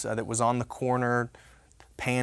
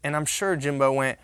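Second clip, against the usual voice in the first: normal talking voice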